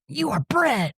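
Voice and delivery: "You are" as two distinct words, pitched high; high mock yell of "You are bread", contraction undone; mock yell, uncontracted. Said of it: "instead of just Y O U R".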